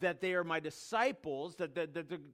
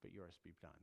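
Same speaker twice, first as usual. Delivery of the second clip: hushed submission; 10 semitones lower, 13 dB quieter than usual. Retold or imitated. Gethsemane submission prayer.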